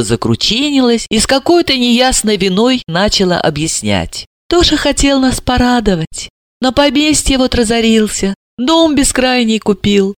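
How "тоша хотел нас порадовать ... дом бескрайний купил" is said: lightly raised voice of a doting mother; a hint of a proud old lady; older mother voice.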